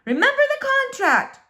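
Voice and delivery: high, loud yell up the stairs; mother calling her daughter upstairs; mother's shout. Acted. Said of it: "her mother shouted".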